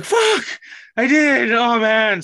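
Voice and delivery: high, loud wail of dismay; mock anguished cry. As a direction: cry out in exaggerated frustration at yourself. Retells being caught, dismayed.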